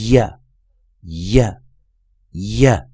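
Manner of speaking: 呀 said loud and clear, repeated three times; teacher's Y sound demo. Shown as a demonstration. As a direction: drill 呀 slowly for the class. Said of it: "这样子的发音".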